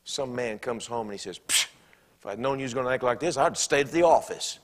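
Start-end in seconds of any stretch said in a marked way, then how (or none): 1.4-4.6 bully. Acted. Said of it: "that's being a bully".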